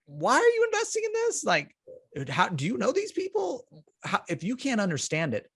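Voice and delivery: mock exasperated lawyer; incredulous. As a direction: sound baffled that anyone would do this. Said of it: "I'm like".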